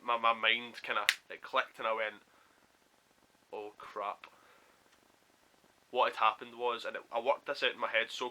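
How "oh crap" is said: low-key, soft retold realisation; dismayed aside. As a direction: drop it softly, as if to yourself. Sinking realisation, said to himself.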